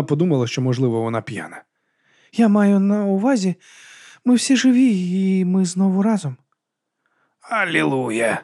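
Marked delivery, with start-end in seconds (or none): 2.3-6.4 higher, slowed character voice; slow, lifted dialogue voice; earnest heroine. A narrator's reading. 7.4-8.4 slow, drawn-out grumble; weary sarcastic hallelujah; grumbled. A narrator's reading. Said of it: "пробурчав Бальтазар".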